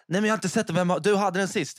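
högre, uppjagat käbbel; stressat försvar. Retold or imitated. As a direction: skyll ifrån dig med högre röst. Spelar upp stressat gräl om telefonen. (stressat försvar)

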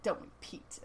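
imitating him in a near whisper; knowing murmur. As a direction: drop to a soft, voiceless aside. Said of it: "And the way he says it".

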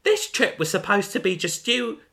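high, sharp accusing friend; Gemma's voice. Acted. Voicing Gemma in the story.

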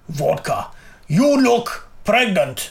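slow, deliberate fake Russian accent; attempted Russian accent. Shown as a demonstration. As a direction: start on a target word, then lay on the Russian. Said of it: "So it's kind of Russian".

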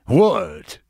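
mock-baffled Rodney, lightly voiced; a hint of a confused, shaken comic; rattled Rodney Dangerfield. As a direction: thrown off balance, sputtering the questions. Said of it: "shook him up".

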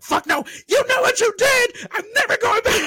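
high, loud yelled refusal; mock furious shout. Refusing the friend's errand, high furious shout. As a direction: scream it out in mock outrage. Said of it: "I was like".

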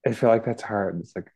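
usual speaking voice

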